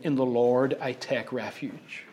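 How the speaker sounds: low, softened, gentle delivery; quiet and soft. Retold or imitated. David on a grassy hillside with his harp, quietly. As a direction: say it softly, as to a harp. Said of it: "quietly, softly singing".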